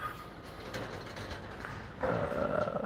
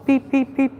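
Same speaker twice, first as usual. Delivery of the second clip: squeaky high beeps; high beep imitation. Copying a shop scanner with high beeps. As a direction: chirp it like a scanner.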